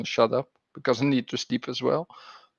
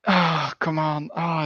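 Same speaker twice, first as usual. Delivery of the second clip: usual speaking voice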